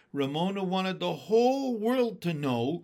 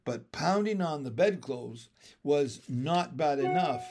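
ordinary delivery

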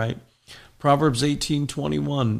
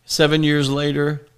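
usual speaking voice

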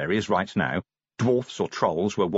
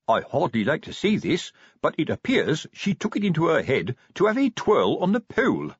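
prim, raised-pitch police report; disapproving sergeant; 6 semitones higher than usual. Acted. Sergeant reporting the cleaner's antics, reluctant. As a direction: recount it reluctantly, with official dignity. Sergeant recounts embarrassing incident.

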